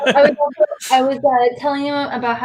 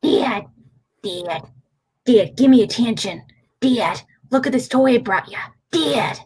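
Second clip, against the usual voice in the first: cat voice. Pretend. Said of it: "the voice I use is like".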